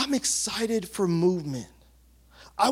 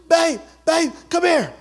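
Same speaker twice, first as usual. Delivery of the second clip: high, loud mock wife calling her husband over; imitating an excited wife calling babe; 11 semitones higher, 7 dB louder than usual. Wife calling husband to feel baby.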